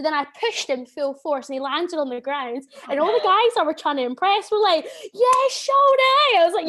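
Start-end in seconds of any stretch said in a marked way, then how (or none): 5.1-6.4 high, loud, drawn-out whoop; cheering crowd. Retold or imitated. Impressed guys cheering after she floors a man, loud mock cheer. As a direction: mimic rowdy lads hollering their approval.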